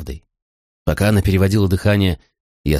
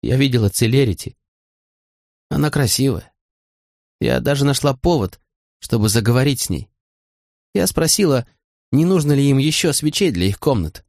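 higher-pitched, lightly voiced upset woman; a hint of unsteadiness in a higher voice; trembling voice; 5 semitones higher than usual. A narrator's reading. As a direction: speak up high with understated hurt. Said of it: "голос её дрожал".

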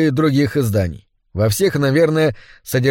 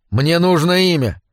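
ordinary delivery, nothing marked

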